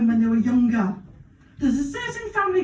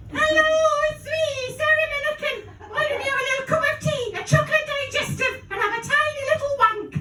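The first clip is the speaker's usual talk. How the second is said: much higher, fast, cosy Geordie-style chatter; Sarah Millican impression; 11 semitones higher, faster than usual. Comic does Sarah Millican for the crowd, high and quick. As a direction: gush it like a cosy comedian. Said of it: "my really good impression of Sarah Millican".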